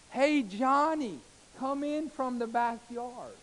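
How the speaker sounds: high, drawn-out call across a backyard; calling from afar. Shown as a demonstration. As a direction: slowly holler for a child to come in. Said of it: "See the difference?".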